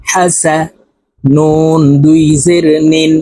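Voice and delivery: normal talking voice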